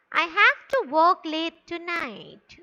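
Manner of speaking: slow, loud statement falling on "tonight"; falling intonation. Shown as a demonstration. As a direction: start high and drop through "tonight". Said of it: "it is falling".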